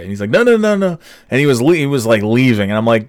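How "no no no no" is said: high, quick string of refusals; mock protest. Customer refusing the offer to pay, high mock protest. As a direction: imitate someone waving off a favor.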